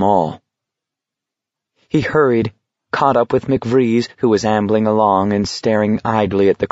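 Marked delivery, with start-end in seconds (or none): none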